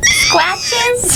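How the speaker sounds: high, pleading little question; pleading high ask. Retold or imitated. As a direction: ask sweetly for scratches. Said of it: "you'd be like".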